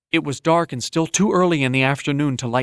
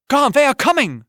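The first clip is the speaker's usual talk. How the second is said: much higher, louder desperate call; urgent warning cry; 12 semitones higher, 5 dB louder than usual. Acted. Sick boy gasps a warning to Garn, urgent. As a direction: warn him with everything left.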